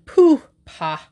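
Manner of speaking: a loud dismissive pooh-pah; scoffing. A narrator's reading. Emsworth scoffing at his secretary's claim, loud and dismissive. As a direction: blow the words away in contempt.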